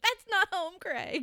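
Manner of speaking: high, loud imitated protest; hammed-up girlfriend shouting; mock yell. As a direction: call after someone in exasperation. Imitates Ashley yelling at Craig.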